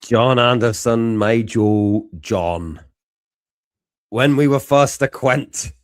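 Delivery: botched Scottish accent veering into Northern Irish; loud attempt at Scots that slips to Northern Irish. Shown as a demonstration. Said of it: "I'm doing it Northern Ireland".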